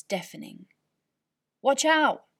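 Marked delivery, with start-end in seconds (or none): 1.6-2.3 loud, high warning shout; alarmed cry. Acted. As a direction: yell to warn him of the dice. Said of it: "Dad cried".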